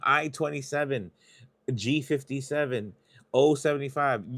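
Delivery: upbeat bingo number calls; calling bingo numbers. Real, not acted. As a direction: sing out each number like a bingo hall caller. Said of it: "I haven't been saying the numbers".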